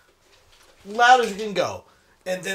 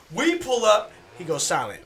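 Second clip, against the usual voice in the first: ordinary talk, nothing marked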